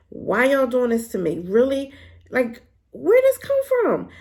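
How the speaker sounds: rising to a high, loud, slow wail of disbelief; exasperated protest. Real, not acted. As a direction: plead with the writers, pitch climbing way up. Complaining to writers about death.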